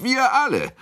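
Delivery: very high, rousing cry; repeated eager shout, pitched high. A narrator's reading. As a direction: yell agreement for everyone. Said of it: "schrie Kunicke".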